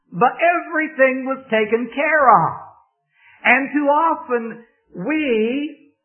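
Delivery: impassioned preacher building to a climax; preaching at full force. Acted. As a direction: drive the point home to the congregation. Sermon rises to full preaching.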